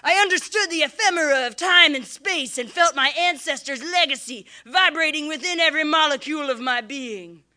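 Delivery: normal talking voice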